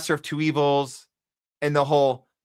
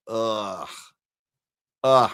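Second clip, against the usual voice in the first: dismayed groan. Real, not acted. Streamer groaning at news of the UK joining the war. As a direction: groan in disgust.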